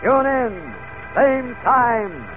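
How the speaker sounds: high, loud thrilling serial-announcer teaser; excited announcer. Acted. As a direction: build the suspense like an old radio announcer. Serial narrator's cliffhanger teaser.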